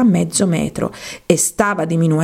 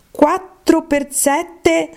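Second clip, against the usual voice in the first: slow, high sing-song times table; slow recitation; 9 semitones higher, much slower than usual. Acted. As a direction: drag out the sum like a schoolgirl reciting. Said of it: "Proviamo un po'". (tabellina recitata lenta e cantilenante)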